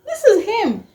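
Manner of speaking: breathless high excitement; elated. Retold or imitated. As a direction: squeal it with disbelieving joy. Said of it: "I was like".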